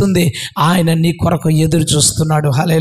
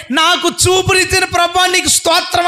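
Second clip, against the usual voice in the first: high, grateful shout; loud cry of praise; 9 semitones higher than usual. Retold or imitated. Healed blind man praising the Lord for sight, shouting. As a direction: cry it out at full force. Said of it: "అని గట్టిగా అరవకుండా ఉంటాడా?".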